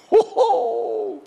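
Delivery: high, loud burst of delighted laughter; preacher laughing with joy at the thought of heaven. Acted. Joyful reaction imagining heaven.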